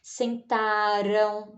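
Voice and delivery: sentaram said slowly with a light tapped r; soft R demo. Shown as a demonstration. Teacher to pupils, showing the weak R. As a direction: draw out sentaram with an easy r. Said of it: "nem faz tanta força pra falar".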